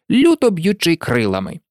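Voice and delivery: ordinary delivery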